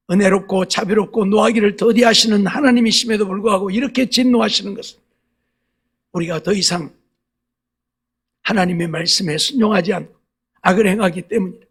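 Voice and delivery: usual speaking voice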